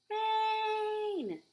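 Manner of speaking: high, loud thrilled shout; excited cry. Acted. Boy thrilled about the rain, excited high cry. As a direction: cheer it like a kid who loves rain. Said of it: "this boy is really excited about the rain".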